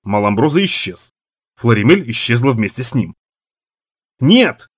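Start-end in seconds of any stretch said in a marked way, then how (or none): 4.2-4.7 loud high shout of no. Acted. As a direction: cry out in protest. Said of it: "закричал Рид Чалмерс".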